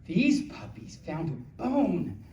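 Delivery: slow, sing-song picture book read; reading to children. Acted. Reading a puppy book to the little audience.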